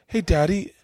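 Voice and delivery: put-on high, sweet little-girl voice; little daughter voice. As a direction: play the small daughter offering a gift. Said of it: "and she went".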